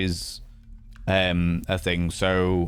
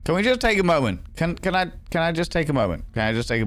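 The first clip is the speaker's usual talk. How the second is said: amused exclamation; 8 semitones higher than usual. Real, not acted. Laughing at own blunder.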